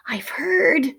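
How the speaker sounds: hushed, breathy secret; whispered. A narrator's reading. Willie whispering a secret to his friend. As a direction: whisper it like a rumour. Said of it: "he whispered".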